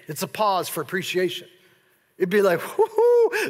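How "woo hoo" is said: a high, loud whoop; celebrating woo-hoo. Retold or imitated. Preacher acting out a joyful pause in Scripture, high whoop. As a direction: cheer like a crowd.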